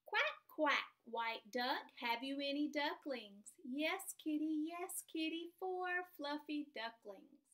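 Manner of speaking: slow, sing-song storybook read; reading to children. Acted. Teacher reads a rhyming story to her class, sing-song. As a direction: read it out slowly, like a picture book at snack time.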